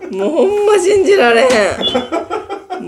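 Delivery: loud, exasperated complaining; angry. Real, not acted. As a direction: scold the prankster loudly. Friend scared her, complaining.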